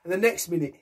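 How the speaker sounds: ordinary delivery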